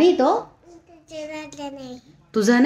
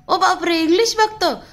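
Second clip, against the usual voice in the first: higher, hammed-up wow for the toddler; mock amazement; 7 semitones higher than usual. Mother teasing her toddler, playful mock surprise. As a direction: gush in fake astonishment at the little one.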